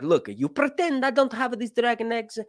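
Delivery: high, mock Italian Mario accent; bargaining in a cartoon Italian Mario accent. Sea Lord offering to wipe the debt in Mario accent. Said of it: "doing the Mario voice".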